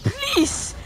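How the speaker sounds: high, drawn-out plea; pleading. Real, not acted. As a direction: beg with a long, high "please". Begging the game opponent to stop.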